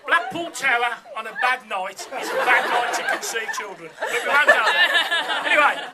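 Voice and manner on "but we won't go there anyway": laughing. Real, not acted. Laughing after a risqué joke.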